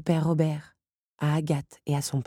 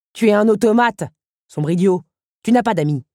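louder, cutting contempt; scornful insult; 5 semitones higher, 7 dB louder than usual. Acted. Henri insults the automaton, scornful and loud. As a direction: spit the insults.

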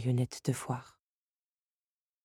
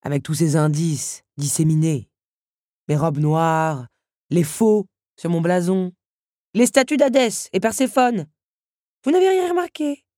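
gloating countess; 2 semitones higher, 8 dB louder than usual. A narrator's reading. Countess gloats over missed clues. (triomphe moqueur qui monte)

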